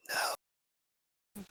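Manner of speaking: a breathy, hoarse, voiceless no; hoarse low voice. Acted. Stranger refuses the visitors with a hoarse, voiceless no. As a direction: rasp the refusal without voice. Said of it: "very, very hoarse, very low tone".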